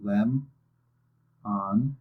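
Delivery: LEM and ON as two separate beats; two clean syllables, a gap between; syllables separately. Shown as a demonstration. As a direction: split lemon in two with a pause. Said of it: "Say both syllables separately first".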